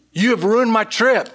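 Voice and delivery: mock-wounded, let her voice climb; upset. Wife on the phone scolding her husband, upset. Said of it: "She, she was so upset".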